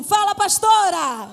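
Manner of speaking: call-out demo. Shown as a demonstration. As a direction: call it out loud like the congregation should. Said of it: "eu quero que vocês falem assim". (gritando "Fala, pastora!" bem alto)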